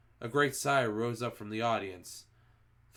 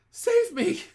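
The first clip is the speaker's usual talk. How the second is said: much higher, louder shriek for help; desperate cry; 10 dB louder than usual. A narrator's reading. Front-row witch crying for rescue, high loud desperate shriek. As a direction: scream for rescue. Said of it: "cried the wretched witch".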